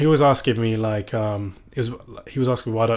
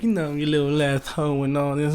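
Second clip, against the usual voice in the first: higher, loosely rapped mock rapper line; mock male rapper; 3 semitones higher than usual. Imitating male rappers talking about women, mock rapper.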